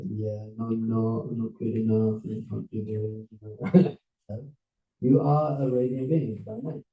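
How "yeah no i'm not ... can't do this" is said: low, soft mock self-doubt; imitating someone who feels unworthy; self-doubting listener. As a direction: mumble it small and defeated. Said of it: "And you're like".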